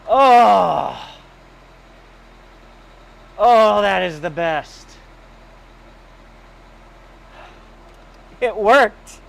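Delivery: loud, high relieved excitement; joyful exclaiming. Real, not acted. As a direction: cheer the engine on. Car starts.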